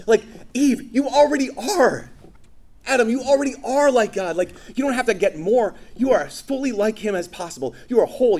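loud, high, emphatic insistence; impassioned preaching. Real, not acted. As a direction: press the truth home to Eve and Adam. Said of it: "preaching at full force".

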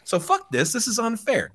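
high whiny mock outrage; mock whine. Owner sums up his grievance to the room, mock whine. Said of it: "then he basically whines".

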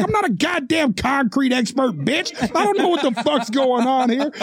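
retold heated comeback; sharing their energy. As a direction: argue back indignantly. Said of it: "I instantly shared their energy".

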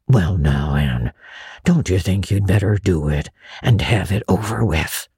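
put-on a shy old man urging gently; hushed old Matthew. A narrator's reading. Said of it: "he whispered".

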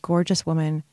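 usual speaking voice

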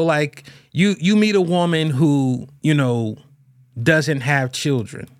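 ordinary delivery

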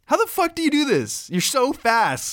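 high-pitched disbelieving outburst; astonished. Acted. Brother sees the painting already finished, astonished. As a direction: stretch it out in amazement.

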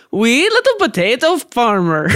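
sing-song Irish-Swedish accent on "Wee little potato farmer"; a friend's mixed Irish-Swedish accent. Shown as a demonstration. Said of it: "let's see if I can still do it".

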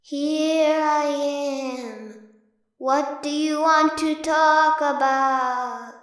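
long drawn-out, singsong ghost moan.

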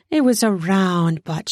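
ordinary delivery

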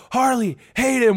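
retold yell for friends; imitated calling out. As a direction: call names as if from afar, without full volume. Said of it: "they're yelling at us".

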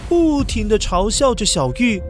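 usual speaking voice

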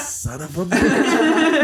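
high, very drawn-out curse; laughing, stretched-out comic dismay; mock exasperated curse. Retold reaction to being cornered.

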